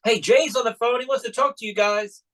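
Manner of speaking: high mock yell down to the yard; calling out. Boy calling his parents from the deck, mock yell. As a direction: call down from the deck. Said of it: "and I yell down there".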